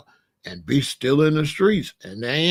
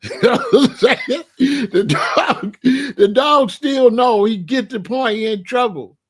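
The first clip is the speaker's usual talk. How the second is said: loud, high, cracking up while explaining; laughing; 6 semitones higher, 6 dB louder, slower than usual. Real, not acted. Telling how the dog knows he's in trouble, laughing. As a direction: laugh through the punchline.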